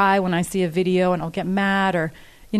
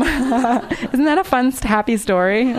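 higher, slow, laughing through the words; 5 semitones higher, slower than usual. Real, not acted. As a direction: laugh at the irony of the sad tale. Ironic joke after sad story.

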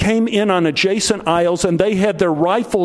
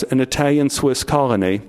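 ordinary talk, nothing marked

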